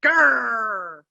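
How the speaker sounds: loud grumpy growl; mama bear growl. Acted. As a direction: growl like a cross mother bear. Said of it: "she grumped".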